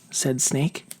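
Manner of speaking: plain reading voice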